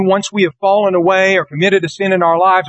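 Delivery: ordinary delivery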